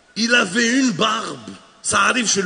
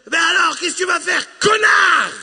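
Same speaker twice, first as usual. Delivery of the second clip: put-on high cheeky child voice rising to a shout; insolent child; 8 semitones higher, 4 dB louder than usual. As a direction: sass your father, shouting connard. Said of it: "dire à leur père". (enfant insolent qui crie connard)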